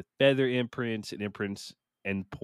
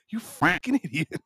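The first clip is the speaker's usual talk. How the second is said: higher, mock scolding of himself; self-scolding; 7 semitones higher than usual. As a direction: call yourself an idiot in jest. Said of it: "And I'm like".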